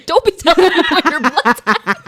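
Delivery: high, loud, through laughter; laughing protest. Real, not acted. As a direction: protest while cracking up laughing. Teasing while laughing hard.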